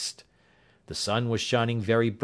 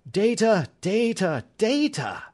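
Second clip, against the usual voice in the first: higher, louder, impatient repeated cry; 12 semitones higher, 4 dB louder than usual. A narrator's reading. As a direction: hammer each word out of impatience. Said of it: "he cried impatiently".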